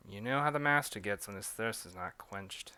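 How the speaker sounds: British servant whispering a confidence; hushed British accent aside; whispered British accent. Acted. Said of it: "switching back between a British and French accent".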